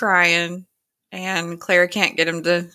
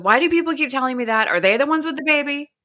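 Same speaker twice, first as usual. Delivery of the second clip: quick, higher-pitched retort; imitated exasperation; 8 semitones higher, faster than usual. Young mother answers reassurance about baby, quick exasperated imitation. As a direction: fire off the questions fast and higher, fed up.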